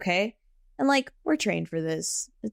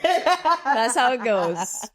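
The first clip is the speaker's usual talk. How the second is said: slow, stretched sing-song line; slow mock-wise delivery; drawn out; 4 semitones higher, much slower than usual. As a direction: drag each word out like a punchline.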